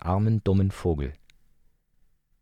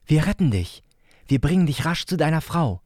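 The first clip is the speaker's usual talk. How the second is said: hohe, sanft zuredende Kinderstimme; fürsorglicher kleiner Bruder; 8 semitones higher, 4 dB louder than usual. Acted. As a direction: liebevoll zur Krähe sprechen wie ein Kind. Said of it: "sagte Leo fürsorglich". (hohe, fürsorgliche Kinderstimme)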